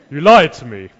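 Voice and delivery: loud hammed-up accusation breaking into a laugh; laughing woman voice. Preacher voicing Delilah's accusation, loud and laughing. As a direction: belt it out as Delilah, cracking up. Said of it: "Can't do my woman voice".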